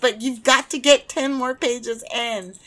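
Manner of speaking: loud, high-pitched insistence; excited urging. Acted. Acts out herself urging team on.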